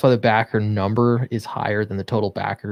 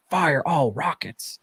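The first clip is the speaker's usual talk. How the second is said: slow, slightly raised command, drawn out; acted battle command; 4 semitones higher, 4 dB quieter, slower than usual. Writer reading Flynn's dialogue to viewers, lightly acted command. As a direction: lightly act out a drawn-out firing order.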